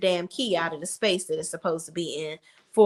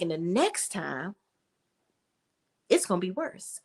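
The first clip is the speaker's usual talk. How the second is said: mock menacing threat; 3 semitones lower, 6 dB quieter than usual. [name] threatens Hayden.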